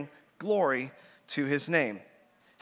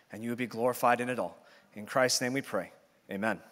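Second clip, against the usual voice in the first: prayer; 4 semitones lower, faster than usual. Acted. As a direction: pray humbly, voice sinking low. Pastor prays before preaching.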